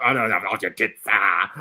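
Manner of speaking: lightly imitated Australian accent; mock Australian accent. Host mimicking the Australian comedian's answer, mock Australian accent. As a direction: mimic an Australian mumbling through it. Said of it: "that got so Australian".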